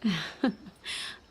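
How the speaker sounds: soft amused laugh. Acted. Queen amused by the compliment.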